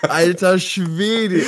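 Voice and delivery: slow, high, amazed Alter Schwede; drawn-out amazement. Real, not acted. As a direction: stretch it out in awe. Amazed at the transition.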